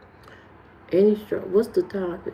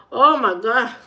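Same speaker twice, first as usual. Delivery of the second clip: usual speaking voice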